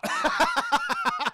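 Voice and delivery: loud squeaky laughter; high-pitched laugh. Real, not acted. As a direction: burst out cackling. Speaker laughs hard.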